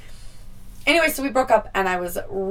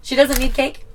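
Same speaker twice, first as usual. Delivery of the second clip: higher, louder disbelieving repeat; incredulous echo; 7 semitones higher, 5 dB louder than usual. Real, not acted. Girl repeating boy's rude words, incredulous. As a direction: throw his words back in shock. Echoing his insult in disbelief.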